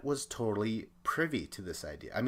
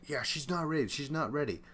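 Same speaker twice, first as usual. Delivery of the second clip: retold protest; 5 semitones higher, faster than usual.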